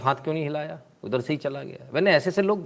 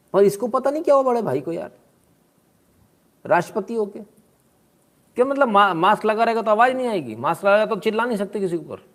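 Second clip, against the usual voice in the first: mock disbelief; 7 semitones higher than usual. Real, not acted. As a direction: act amazed at the absurdity. Incredulous mockery of shouting. (हैरानी भरा मज़ाक)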